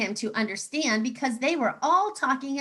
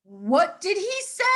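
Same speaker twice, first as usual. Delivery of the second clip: high puzzled cry; cried out; 8 semitones higher, 3 dB louder than usual. Acted. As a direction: call out in disbelief. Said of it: "cried the frogs".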